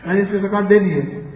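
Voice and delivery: soft, drowsy repeat; sleepy murmur. Retold or imitated. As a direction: murmur it like a man too lazy to get up. Owner repeats to thief, sleepy.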